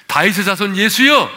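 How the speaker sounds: high, loud call rising to the end. Retold or imitated. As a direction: cry out over the crowd for Jesus. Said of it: "더욱 크게 소리를 질렀어요".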